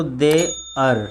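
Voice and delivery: देयर drawn out as de-ar with sounded r; Hindi-style reading. Shown as a demonstration. Teacher reading it by Hindi spelling, देयर with r pronounced. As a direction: split देयर, landing on the r. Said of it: "Hindi के अनुसार पढ़ें".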